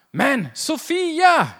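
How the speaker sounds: drawn-out exasperated shout; calling out in pain. Retold or imitated. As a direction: yell her name as if you just drilled your thumb. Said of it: "då kan ju jag i och för sig ropa".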